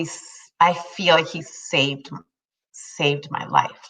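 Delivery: slow, soft and sincere; tender. Real, not acted. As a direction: speak it gently from the heart. Heartfelt tribute to husband.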